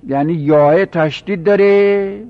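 normal talking voice

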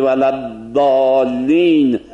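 "ولدالین" drawn out very slowly with an emphatic d; Meccan recitation. Retold or imitated. Teacher imitating the Masjid al-Haram imam's recitation, very slow. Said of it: "امام مسجد الحرام ... میگه".

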